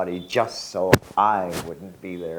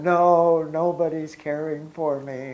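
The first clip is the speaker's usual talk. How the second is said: raised, louder, a tearful mock wail; crying complaint; 8 semitones higher, 6 dB louder than usual. Baba cries in dream to disciple, tearful wail. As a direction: voice the guru's crying plea. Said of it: "And he's crying".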